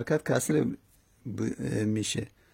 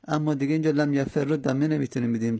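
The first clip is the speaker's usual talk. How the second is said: normal talking voice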